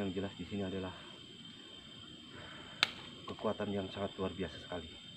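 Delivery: hushed, nervous report; nervous night vlog whisper. Real, not acted. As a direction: keep it low and uneasy. Hushed report at haunted site.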